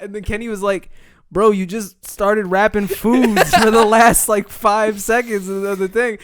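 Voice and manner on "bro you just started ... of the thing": loud, slow, higher-pitched retelling; mock disbelief. Producer questioning the rap, imitated loud and incredulous.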